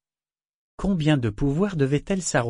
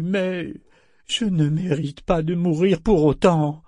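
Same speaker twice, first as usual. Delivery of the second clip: whiny governor; 4 semitones higher, 5 dB louder than usual. Acted. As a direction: plead like a spoiled young lord. Governor pleads his case.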